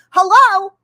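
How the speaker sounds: high, loud, disbelieving Hello?; incredulous hello. Real, not acted. Recalling the priest's last rites, a stunned loud Hello?.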